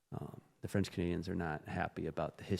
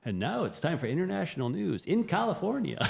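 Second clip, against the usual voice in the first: high, loud parody newscaster; mock news announcer; 9 semitones higher, 5 dB louder than usual. American joking about insular international news, mock announcer voice. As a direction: announce it grandly, joking, like a TV anchor. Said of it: "I made this joke all the time".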